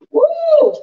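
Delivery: high, loud woo; excited whoop. Real, not acted. Cook whooping over her fragrant pan. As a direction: whoop as the smell hits.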